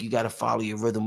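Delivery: ordinary talk, nothing marked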